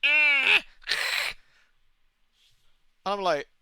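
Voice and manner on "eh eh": high, loud creaky animal screech then a rasp; angry possum noise; possum hiss imitation. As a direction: imitate a cornered possum hissing. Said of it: "rat pig just goes".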